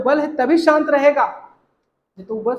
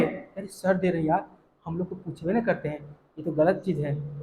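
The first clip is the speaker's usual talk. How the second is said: softer, muttered grumble of a servant left out; grumbling employee; 7 semitones lower, 8 dB quieter than usual. Acted. Teacher voicing a hurt employee, softly grumbling. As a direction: mutter it like an employee who feels ignored.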